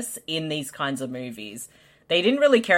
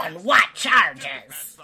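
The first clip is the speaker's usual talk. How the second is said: slow dramatic movie line; hammed-up action movie delivery; 2 semitones lower, much slower than usual. Mock movie cop line.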